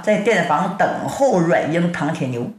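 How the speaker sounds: usual speaking voice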